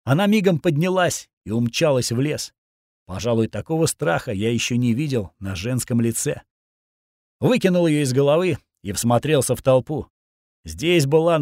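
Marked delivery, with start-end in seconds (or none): none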